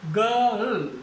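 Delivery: गर्ल said with no r sound; गर्ल, the r dropped before l; girl without r. Shown as a demonstration. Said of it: "ए और रे यदि mix". (गर्ल बिना र के)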